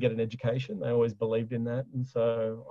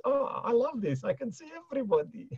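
much higher, delighted mother voice; imitating mum; 11 semitones higher than usual. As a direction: play the proud Greek mum.

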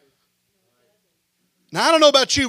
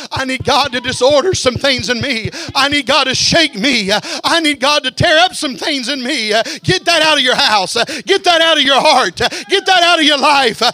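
building preacher's chant, louder line by line; preaching; 3 semitones higher, 4 dB louder than usual. Acted. Preacher urging the church to change, building chant. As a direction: drive each repeat harder.

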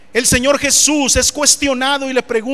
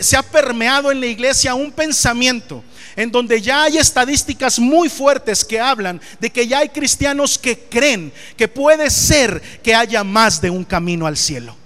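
ordinary delivery

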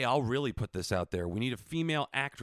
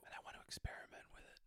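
whispering; 18 dB quieter, faster than usual. Real, not acted. Sudden whispered exchange.

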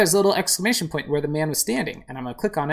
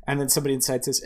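ordinary delivery

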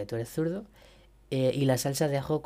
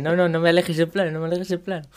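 high pleading TV correspondent; 4 semitones higher, 6 dB louder, faster than usual. Retold or imitated. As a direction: beg the cameraman not to pull back. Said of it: "Y dicen".

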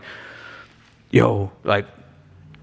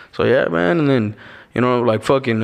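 normal talking voice

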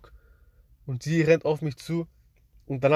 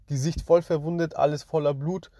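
normal talking voice

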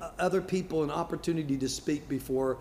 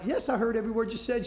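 quick, higher insistence; defensive reply; 8 semitones higher, faster than usual. Retold or imitated. Husband quickly insists to his wife he listened. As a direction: rattle it off, a bit defensive. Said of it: "And I'm like".

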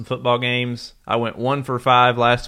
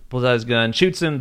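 usual speaking voice

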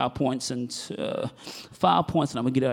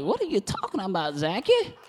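lightly acted puzzled elderly wife, rising at the end; mock old-lady question; old woman; 7 semitones higher than usual. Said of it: "remember, she's old".

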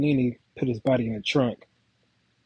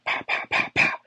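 whispered gunshot sounds. Retold or imitated. Imitating gunshots in the story.

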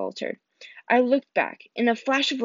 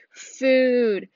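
dazed, moaned word; moaning. Acted. Injured satyr in the back seat, moaning. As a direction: moan it half conscious. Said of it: "Grover moaned a little louder".

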